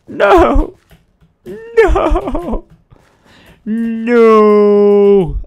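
loud, high hammed-up despair; anguished calling. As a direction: call out, building to long wailing noes. Calls out for missing villagers.

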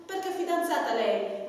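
ordinary talk, nothing marked

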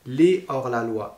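"les hors la loi" with no z after les; no liaison. Shown as a demonstration. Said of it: "et pas".

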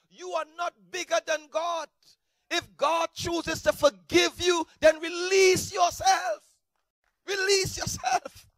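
loud, slow, high exhortation; full-force preaching. Acted. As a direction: drive it home from the pulpit, slowly.

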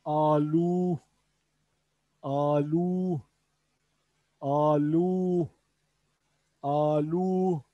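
Alu said with long open vowels; Urdu pronunciation. Shown as a demonstration. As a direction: model Alu for repetition, slow and clear. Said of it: "Urdu'da patates anlamına geliyor". (Alu kelimesini açık ünlülerle tekrarla)

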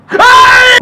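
very high, loud shriek; shrieked yelp. Real, not acted. Player startled by the crying child, high shriek. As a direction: shriek out in sudden fright. Sudden scare.

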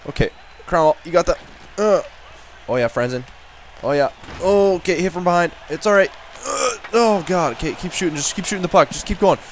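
usual speaking voice

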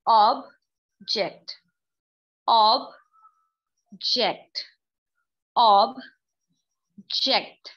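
Object very slowly, long silence between its two syllables; sounded out slowly. Shown as a demonstration. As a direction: break each object into two separate beats. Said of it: "मैं इस वक्त अब बोल रही हूँ इस sound को".

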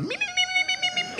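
very high-pitched run of "me, me" squeals; imitating Shemp Howard. Comic tells a crowd his dick's sound, mimicking Shemp Howard, very high. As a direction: squeal it like a Three Stooges bit. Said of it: "He was just going".

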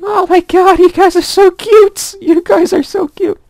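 very high, slow gushing squeal; squealing over cute bunnies; cutesy squeal. Real, not acted. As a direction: melt over the bunnies out loud.

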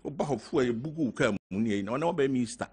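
usual speaking voice

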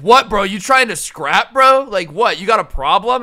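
loud mock challenge, jumping up on "bro"; in-your-face. Truck guy gets up in the cashier's face, mock confrontational. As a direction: imitate a guy squaring up for a fight. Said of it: "he gets up in my face".

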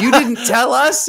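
high, slow mock-wounded complaint; upset parents. Parents calling their son about the move, mock upset. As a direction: imitate a parent's loud protest.

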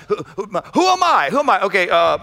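ordinary delivery

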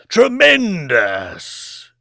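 long drawn-out, high exclamation; exclaiming lord. Acted. Lord exclaims as the screen goes blank, drawn-out delight. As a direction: stretch it out in relish. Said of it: "exclaimed the Lord".

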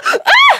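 short loud high scream; alarmed scream. Acted. Nancy gets a sudden fright and screams out loud. Game effort, alarmed cry.

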